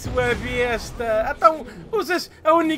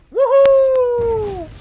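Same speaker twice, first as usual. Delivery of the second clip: high, louder whoop of joy; victory whoop; 10 semitones higher, 8 dB louder than usual. Real, not acted. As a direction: celebrate the win out loud. Victoire en jeu.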